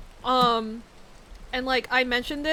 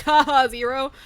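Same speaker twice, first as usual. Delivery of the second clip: loud, hammed-up punchline with a fake laugh; mock-proud joke delivery; 8 dB louder than usual. Streamer replaying their old joke answer to someone, hammed-up. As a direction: imitate yourself landing a corny joke, loudly. Acts out own recurring joke reply.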